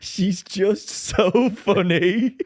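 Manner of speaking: words squeezed out through laughter; laughing. Real, not acted. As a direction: laugh through it, slowly and high. Cracking up over Harriet.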